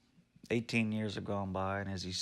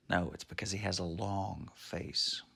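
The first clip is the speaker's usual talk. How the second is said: ordinary delivery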